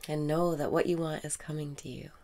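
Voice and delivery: calm meditation voice drifting into whispers; guided meditation. Acted. Guiding a listener through breaths and affirmations, soothing meditation voice. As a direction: lead the listener's breathing gently, in and out.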